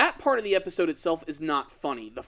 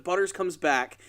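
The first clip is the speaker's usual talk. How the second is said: ordinary talk, nothing marked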